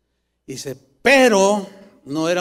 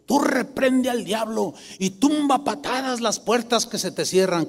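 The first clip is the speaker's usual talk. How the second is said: mock shouted rebuke, higher but held back in volume; imitando a creyentes exaltados; 4 semitones higher, 5 dB quieter than usual. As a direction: parody an over-zealous believer rebuking the devil. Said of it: "¿Qué hacen algunos?". (imitación burlona de un creyente que reprende, voz aguda)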